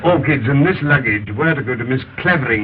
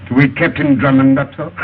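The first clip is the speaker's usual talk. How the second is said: ordinary talk, nothing marked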